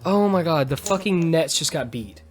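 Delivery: ordinary delivery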